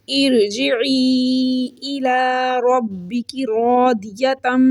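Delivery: slow, drawn-out tajweed chant; Quranic recitation. Shown as a demonstration. Teacher recites the verse for her students, slow chant. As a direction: recite the verse slowly, stretching each vowel. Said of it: "ये आयत आती है".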